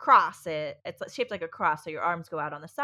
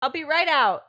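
loud, higher call to another room; raised parental shout; 8 semitones higher, 10 dB louder than usual. Real, not acted. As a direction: call it through a closed door. Calling to child in another room.

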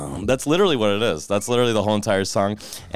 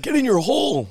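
high, shouted golf taunt; Happy Gilmore voice. Pretend. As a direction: bark it at the ball. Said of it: "Happy Gilmore?".

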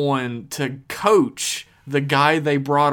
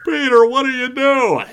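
Patrick Warburton; 11 semitones higher, 8 dB louder than usual. Shown as a demonstration. Podcaster continuing a celebrity impression, loud and high. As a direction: belt it out as an exaggerated impression. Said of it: "I can do Patrick Warburton".

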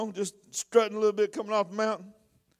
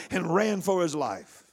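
dropped low; 6 semitones lower, 5 dB quieter, slower than usual. Acted. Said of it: "drops suddenly".

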